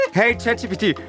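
usual speaking voice

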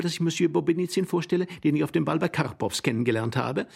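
high, sweet society chatter; gushing lady. Acted. Lady introduces Bobinizin to her husband, gushing sweetly. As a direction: gush in a high lady's voice.

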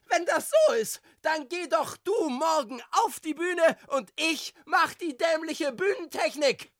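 high-pitched angry yelling; furious screaming. A narrator's reading. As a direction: scream back in the heat of a quarrel. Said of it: "Woraufhin Ralfson geschrien hatte". (wütendes Schreien)

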